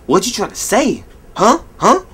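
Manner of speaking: high mock baffled voice; confused audience. Audience not getting the vitamin C joke, high mock confusion. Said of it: "They're like".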